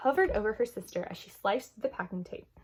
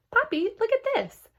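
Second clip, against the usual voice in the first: high, louder call to dad; eager little girl; 5 dB louder than usual. A narrator's reading. Girl calls father.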